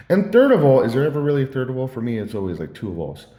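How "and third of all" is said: loud, high mock enthusiasm; sweet-talking gush. Speaker listing pretend thanks to an advice giver, gushing. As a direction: announce it with overblown flattery. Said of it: "sweet talked to him".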